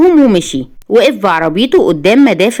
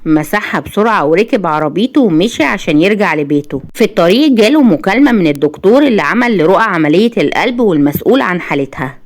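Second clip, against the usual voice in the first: plain reading voice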